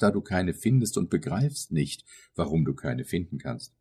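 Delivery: ordinary delivery, nothing marked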